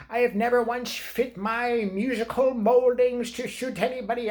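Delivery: high, slow, grandiose mock diva voice. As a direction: pronounce it like a self-important diva. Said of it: "put out some tweet like".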